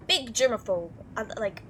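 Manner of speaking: ordinary talk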